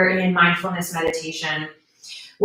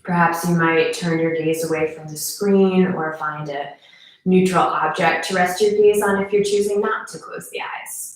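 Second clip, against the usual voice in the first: guided meditation; 2 semitones lower than usual. Acted. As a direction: guide them gently into stillness, unhurried.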